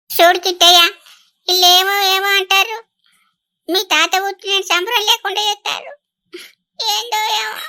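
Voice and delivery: high-pitched, weepy grievance; tearful wailing. Acted. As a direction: sob out a complaint to the grandson. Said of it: "ఏడవకే ముసల్దానా".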